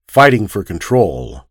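plain reading voice

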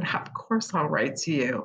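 whispered softly, a quiet promise; tender whisper. Retold or imitated. She whispers the promise back to the woman leaving. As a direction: breathe it gently, like comforting someone close. Tender reply to a clinging friend.

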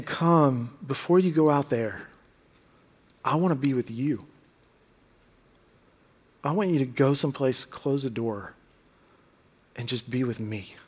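low, soft tender plea; tender, hushed voice of Jesus inviting; gentle invitation. Acted. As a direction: invite them in softly as Jesus.

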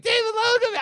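name squealed very high; excited squeal of his name; mock scream. Emailing David in capitals, a high mock scream. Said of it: "I'm, like, screaming".

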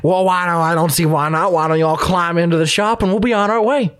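a hint of an old frontier prospector; folksy old miner greeting. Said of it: "an old prospector".

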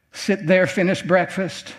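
normal talking voice

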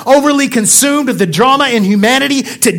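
loud, high-pitched sermon delivery; impassioned pulpit exhortation; preaching at full force. Real, not acted. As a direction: preach it hard to the congregation, punching key words.

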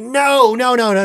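hammed-up exasperated protest; mock protest. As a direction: cut him off in disbelief. Neil quoted protesting.

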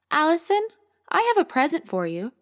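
lightly voiced young girl, eager; little girl Amy. A narrator's reading.

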